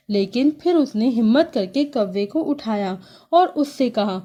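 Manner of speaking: usual speaking voice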